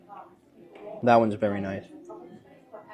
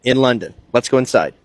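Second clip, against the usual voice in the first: much louder, higher, bubbly announcing; excited vlog intro; 4 semitones higher, 12 dB louder than usual. Real, not acted. American vlogger greeting viewers at the Beaumont Mayfair, loud and excited. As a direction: sell the outing like a big reveal. Vlogger opens tea video.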